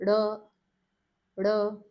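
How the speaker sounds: "R" with tongue rolled in, clicked out loudly. Shown as a demonstration. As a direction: roll in and click out the "R". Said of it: "The rolling in and clicking out".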